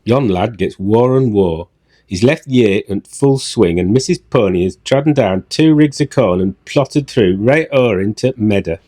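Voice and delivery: deep, broad Yorkshire servant; Yorkshire dialect. Shown as a demonstration. Husband reading another Joseph example, Yorkshire dialect. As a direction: recite it low in thick Yorkshire dialect. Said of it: "another example".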